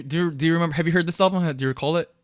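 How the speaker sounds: ordinary talk, nothing marked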